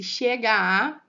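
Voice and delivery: "chega a" loud and slow, final vowels fused; vowels run together. Shown as a demonstration. Teacher showing how the vowels join, run together. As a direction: stretch "chega a" into one long open a. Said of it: "pensa na sonoridade".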